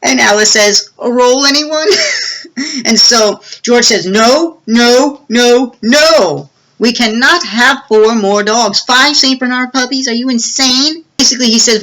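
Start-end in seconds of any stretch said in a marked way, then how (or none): 1.0-2.8 awkward laugh. Retold or imitated. Mom changing subject awkwardly. 4.0-11.0 exasperated refusal. Retold or imitated. Dad refusing the puppies.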